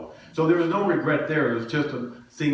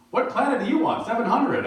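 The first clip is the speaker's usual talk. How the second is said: incredulous friend; 10 semitones higher than usual. Retold or imitated. Friend scoffing at the pastor's guess, high incredulous. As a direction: scoff like he can't believe the guess.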